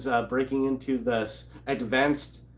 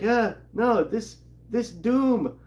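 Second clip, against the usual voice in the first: mock whiny; 8 semitones higher than usual. Fan groaning about the Doom storyline, mock whine. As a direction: whine it like you're fed up with Doom. Mock fed-up self quote.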